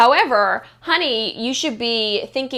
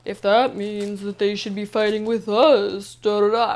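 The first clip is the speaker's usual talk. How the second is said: slow droning imitation of Amber; monotone voice. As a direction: drag it out flat and bored. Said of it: "like, monotone voice".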